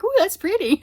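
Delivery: high, loud cooing over yarn; delighted gushing. Acted. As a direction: gush as if spotting something pretty. Acts delight at pretty yarn.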